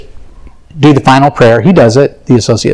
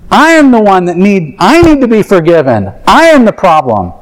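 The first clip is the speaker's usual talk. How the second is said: ordinary delivery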